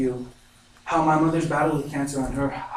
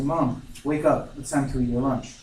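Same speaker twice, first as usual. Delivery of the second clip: ordinary delivery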